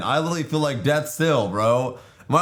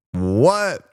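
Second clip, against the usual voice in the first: ordinary talk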